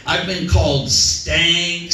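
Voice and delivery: ordinary talk, nothing marked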